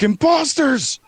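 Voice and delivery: very high, loud gnome shriek; panicked accusing yell. Acted. Third gnome running out to accuse the others, panicked shriek.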